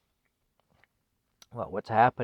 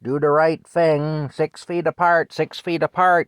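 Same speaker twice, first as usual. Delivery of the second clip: loud, higher-pitched mock public nagging; insistent nagging; 6 semitones higher, 8 dB louder than usual. Man mimicking pandemic messages hammered at the public, loud nagging. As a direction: chant the orders loudly, over and over.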